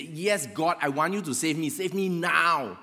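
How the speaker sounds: loud, high begging to be saved, slowing on the last words; happy, urgent plea. Retold or imitated. Man on the branch answers God with relief, happy urgent shouting. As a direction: call out eagerly to your rescuer. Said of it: "of course, happy".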